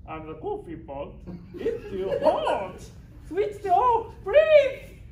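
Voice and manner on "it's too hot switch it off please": very high, loud shriek of pain; shrieking plea. Acted. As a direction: shriek to be turned off. Pot screams again, begging.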